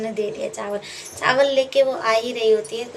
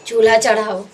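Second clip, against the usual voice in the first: angry command; 7 dB louder than usual. Acted. Angry youngest brother orders the stove lit.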